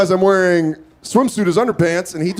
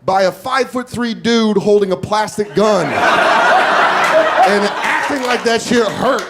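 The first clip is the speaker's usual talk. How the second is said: ordinary delivery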